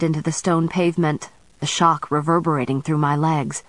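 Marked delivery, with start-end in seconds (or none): none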